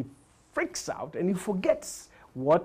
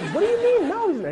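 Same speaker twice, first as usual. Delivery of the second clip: put-on high indignant kid; 3 dB louder, faster than usual. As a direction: shoot the voice way up in protest.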